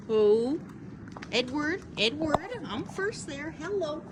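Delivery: high, sing-song pet voice; talking to a horse. Real, not acted. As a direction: greet the horses sweetly. Greeting horses crowding her.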